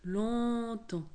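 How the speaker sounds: long, lingering "longtemps"; drawn-out repetition. A narrator's reading. Narrator shows the crocodile pondering at length, drawn out.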